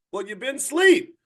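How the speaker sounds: ordinary talk, nothing marked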